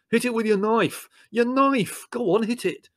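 high, urgent commands amid chaos; frantic urging. Acted. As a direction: egg them on frantically in a high voice. Urging attack on scorpion.

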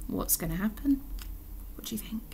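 soft, conspiratorial question; hushed aside. Real, not acted. Reader asking children to guess, hushed aside. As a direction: drop to a near-whisper for the listeners.

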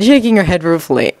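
ordinary delivery

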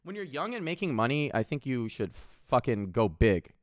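ordinary delivery

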